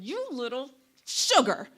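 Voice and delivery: put-on comic flare of frustration at a baby; exasperated near-curse. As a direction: burst out as if swallowing a swear word. Mother swearing at baby, censored.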